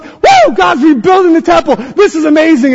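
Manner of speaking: high, whooping crowd excitement; whoop, then shout it out in celebration; hooting and hollering. Acted. Preacher acting people celebrating the rebuilt temple, hollering. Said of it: "they're shouting, hooting, and hollering".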